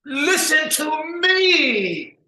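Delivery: slow, high, loud furious command; angry shout. Acted. Nabalac losing patience with Du Bois, shouting. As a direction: demand his attention, drawn out.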